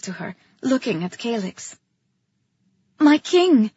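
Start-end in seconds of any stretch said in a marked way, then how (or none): none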